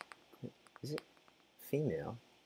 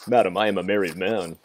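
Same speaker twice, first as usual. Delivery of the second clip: mock indignant; 8 dB louder than usual. Mock refusal of character's flirty invitation.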